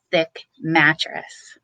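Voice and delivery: ordinary delivery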